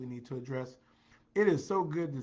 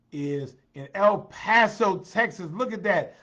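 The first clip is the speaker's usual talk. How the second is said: louder, higher, punching the place name; excited announcement; 6 semitones higher, 10 dB louder than usual. Real, not acted. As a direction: marvel loudly at how far away. Excitedly announcing far-away viewer.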